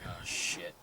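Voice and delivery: low, very soft mock dread; quiet dread. About to compete for first chair, quiet dread. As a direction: drop low and hushed, bracing yourself.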